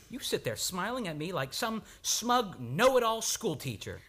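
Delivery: rising complaint, half teasing, half fed up; exasperated outburst. Acted. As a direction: vent your irritation at the smug doctor. Lover's mock complaint at doctor.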